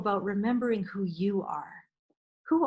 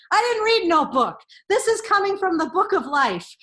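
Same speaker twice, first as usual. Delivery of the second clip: sassy retort; 7 semitones higher, 6 dB louder than usual. Acted.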